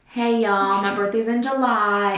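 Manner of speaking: slow imitated Kentucky twang, long vowels; Kentucky accent. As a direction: stretch it out like a Kentucky local. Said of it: "moved to Kentucky and people are like".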